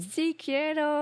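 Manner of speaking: put-on slow, high joking Mexican accent echo; mock Mexican accent. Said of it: "you do the Mexican".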